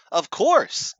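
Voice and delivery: high, imitated emphatic assent; dramatic agreement. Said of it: "agreeing dramatically with you".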